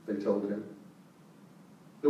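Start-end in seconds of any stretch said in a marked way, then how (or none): none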